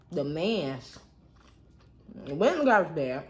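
chewing while talking; speaking between bites; mouth full. Real, not acted. Seen 0: Puts crawfish in mouth at -2, pursed chewing lips while talking.

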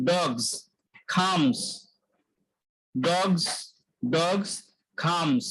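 ডগস and খামস ending in z; z ending demo. Shown as a demonstration. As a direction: stress the z at the end of ডগস. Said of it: "উচ্চারণ জ আসছে". (শেষে z দিয়ে ডগস, খামস)

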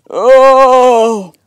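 long, loud sickly groan. A narrator's reading. Sick brother refusing tea, groaning loudly. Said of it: "Lazarus just groaned".

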